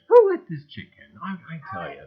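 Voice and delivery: high, loud mock outrage; mock indignation. Host teasing a friend who joined the chat, mock indignant. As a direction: pretend to be shocked she's here.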